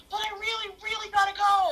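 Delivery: a hint of a desperate little boy begging; pleading kid. Imitating a kindergartner begging his teacher, lightly whiny.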